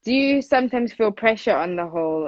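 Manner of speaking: usual speaking voice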